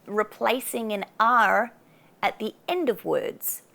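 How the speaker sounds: ordinary delivery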